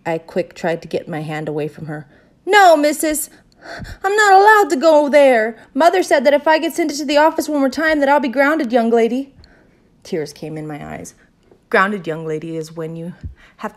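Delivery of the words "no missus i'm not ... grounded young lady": high, loud pleading refusal; distressed protest. A narrator's reading. Girl refuses the teacher's trip to the office, distressed. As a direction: protest loudly, upset and pitched up.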